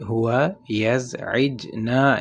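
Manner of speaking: slow, careful teacher demo. Shown as a demonstration. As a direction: lay out each syllable. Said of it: "اور اگر آپ نے کہنا ہو". (آہستہ عربی جملہ)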